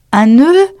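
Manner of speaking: high, loud, eager Alice; bright-eyed offer, pitched right up; eager to help. A narrator's reading. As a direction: pipe up keenly, ready to help. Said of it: "toujours prête à rendre service".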